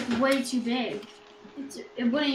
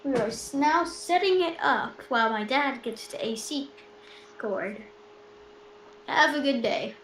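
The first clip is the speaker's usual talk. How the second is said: presenter voice; 2 semitones higher, 8 dB louder, slower than usual. Pretend. Kid takes over as stream host.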